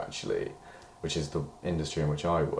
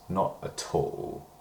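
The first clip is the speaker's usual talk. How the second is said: normal talking voice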